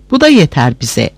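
ordinary delivery

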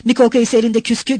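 ordinary delivery

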